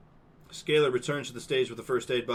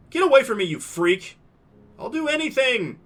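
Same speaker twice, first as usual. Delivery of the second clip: screaming; 12 semitones higher, 10 dB louder than usual. Acted. Mia rejecting, Ben pleading.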